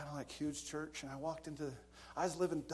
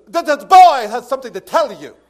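very loud, high commanding shout; stern nun's bellow; 9 semitones higher, 29 dB louder than usual. Retold or imitated. Nun presenting a boy to the officer, stern bellow. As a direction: boom it out to the whole hall.

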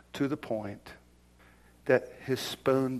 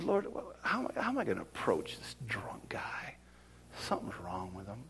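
urgent whisper to God, much softer; whispered prayer; 3 semitones lower, 10 dB quieter than usual. Retold or imitated. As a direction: hush it, as if praying under your breath. Arguing with the Holy Spirit.

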